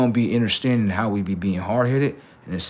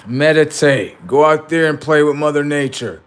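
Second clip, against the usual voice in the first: loud, insistent orders; forceful scolding order; bossy command; 4 semitones higher, 6 dB louder than usual. Acted. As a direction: lay down the law, voice raised. Strict self giving orders.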